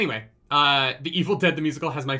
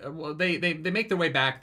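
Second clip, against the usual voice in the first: ordinary talk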